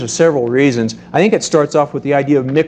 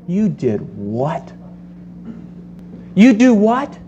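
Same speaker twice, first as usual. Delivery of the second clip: judgmental disbelief; 5 semitones higher, slower than usual. Retold or imitated. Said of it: "gives them that look like".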